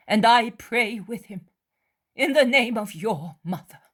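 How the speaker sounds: high, loud, insistent plea; earnest pleading woman. Acted. Mary insisting to Judas he was not spied on. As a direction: press him gently but firmly.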